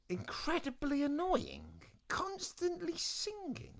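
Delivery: very high, slow disbelief; incredulous rereading. Acted. Eliza frowns at the parchment, high incredulous reading. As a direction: draw it out high, word by word.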